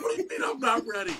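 high, quick retold objection; indignant protest. Protesting to his dad on the phone, a high quick indignant question. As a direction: rush out the offended question.

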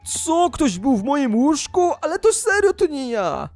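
high-pitched, disbelieving outcry; indignant shock. Acted. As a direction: protest your innocence, aghast. Finds trail at his own bed.

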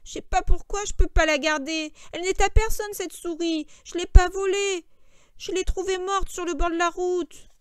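high, loud, plaintive protest; plead, voice wavering a little; slightly quavering voice. A narrator's reading. Lenny protesting he didn't steal the mouse, quavering. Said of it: "Sa voix chevrota légèrement".

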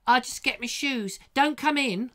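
put-on high-pitched young boy voice; child friend answering. Boy answering his friend at the door, high child voice. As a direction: pipe it up like a kid at the door. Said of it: "And he'd go".

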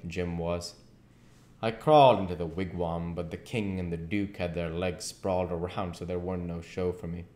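usual speaking voice

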